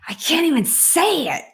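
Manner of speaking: high, cracking voice, near tears; choked up and unable to finish; voice breaking. Real, not acted. Overcome mid-sentence on livestream.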